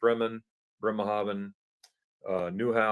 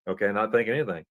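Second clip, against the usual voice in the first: ordinary talk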